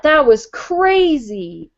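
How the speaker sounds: slow, drawn out in disbelief; slow amazed thought. Retold or imitated. Remembering the strange phone call, slow and amazed. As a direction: let each word hang, amazed. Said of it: "And I thought".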